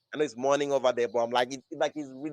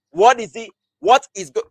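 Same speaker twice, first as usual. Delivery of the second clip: higher, louder baffled questioning; bewildered disbelief; 6 semitones higher, 9 dB louder than usual. Acted. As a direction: act out a confused fan's loud demand for answers. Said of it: "you have the, "What is going on here?" feeling".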